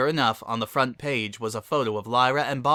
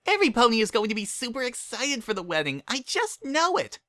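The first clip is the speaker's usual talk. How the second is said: squeaky cheerful pony; high excited Pinkie voice; Pinkie's voice; 12 semitones higher than usual. A narrator's reading.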